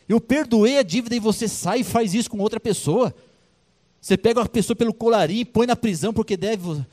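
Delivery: king rebuking the servant who showed no mercy; angry king's rebuke. Acted. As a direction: tear into the ungrateful servant. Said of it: "O rei ficou revoltado".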